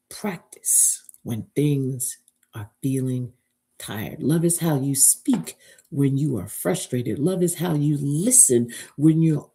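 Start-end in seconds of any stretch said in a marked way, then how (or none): 0.1-4.2 drops to a low, intimate whisper; soft, breathy sermon whisper for emphasis. Acted. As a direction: hush it down so they lean in.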